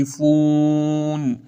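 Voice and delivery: slow یختلفون with a held still n; long hummed final ن in یختلفون; model recitation. Shown as a demonstration. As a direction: draw out "fuun" closing یختلفون. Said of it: "نون آخر هم تکون نمیخوره".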